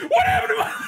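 very high panicked shriek. Pretend. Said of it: "rose voice continued".

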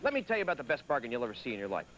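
ad pitch. Acted. Pitchman selling shredder.

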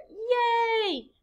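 high happy yay; happy cheer. Shown as a demonstration. Teacher acting her happy self, high cheer. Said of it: "I have my happy self over here".

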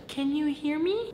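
lightly voiced creepy little girl; a hint of a ghostly girl asking. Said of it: "sound of a girl saying".

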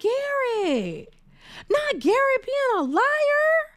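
high, stunned squeal over Garrett lying; mock disbelief. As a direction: squeal at the news in high pitch.

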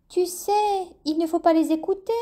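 high, quick reassurance; hurried bat voice. A narrator's reading. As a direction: rush to reassure him. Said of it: "s'empressa-t-elle d'ajouter".

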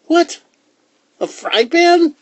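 very high, loud disbelief; loud, high-pitched outraged surprise; incredulous cry. A narrator's reading. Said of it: "cried Herschel".

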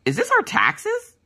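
slow, high, baffled question; husband asking about the check; high puzzled question. Retold or imitated. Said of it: "He goes".

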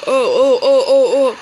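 half panic, half laugh; real thrilled whoops; excited laughing oh. Real, not acted. Frantic moment in game.

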